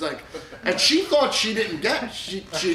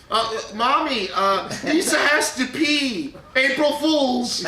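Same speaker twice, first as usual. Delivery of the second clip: put-on higher, slower kid voice; imitating a child; 6 semitones higher, slower than usual. Father imitates his son telling mommy the prank, slow childish voice. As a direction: play the little boy reporting it. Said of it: "I overhear Aiden go".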